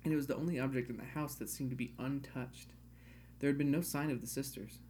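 ordinary delivery

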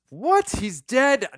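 high, loud startled disbelief; mock shock. Host recalling Sixth Sense ending, loud high mock shock. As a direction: squeal it like the twist just landed. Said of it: "I'm like".

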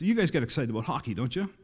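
usual speaking voice

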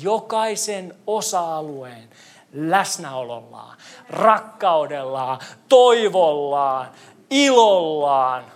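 each word drawn out, slowly; slow, weighty preaching cadence; slow solemn list. Acted. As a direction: lay down every word like a promise. Slow solemn list of God's gifts.

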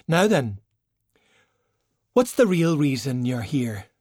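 knowing. Acted. China to Valkyrie, demanding the real reason, knowing and pointed. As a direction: call her bluff, sharp and knowing.

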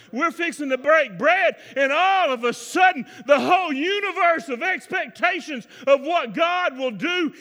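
high, loud, shouted preaching that builds; a pastor shouting to the congregation at the climax; full-force preaching. Acted. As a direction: preach it with everything you have. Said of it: "let me get in there and preach".

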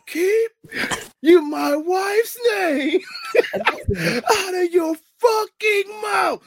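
high, drawn-out imitation of an angry shout; hammed-up furious outburst, stretching out every word; mock outraged yell. Re-enacts the famous slap outburst.

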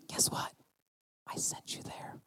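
breathy whispered reveal. Acted. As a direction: whisper it like a secret. God's words in whisper.

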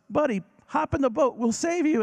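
high, loud friendly call; boat driver calling. Retold or imitated. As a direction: shout over the water to him.

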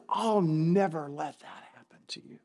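mock-secret whisper from Peter; hushed whisper. Peter rebuking Jesus, retold.

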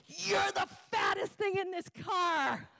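high, drawn-out mock shout; hammed-up, high-pitched furious outburst; wife lashing out. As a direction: imitate wife shrieking an insult slowly. Retold wife lashing out at husband.

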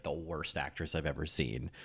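usual speaking voice

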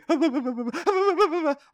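high, quavering fake blubber; hammed-up frightened whimper; mock whimpering. Victim hearing the gun behind him, mock whimpering. Acts victim's noise before being shot.

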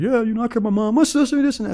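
inmates answering. Retold or imitated. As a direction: imitate the guys piping up in reply. Mimicking the inmates' replies.

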